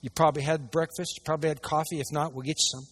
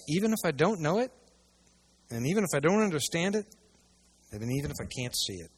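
ordinary talk

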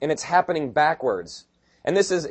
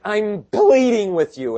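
higher and louder, slowly pressing the plea; a pleading apostle, raised and slow; earnest plea; 8 semitones higher, 7 dB louder, slower than usual. Retold or imitated. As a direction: beg them earnestly, slowly and loud. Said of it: "he's like".

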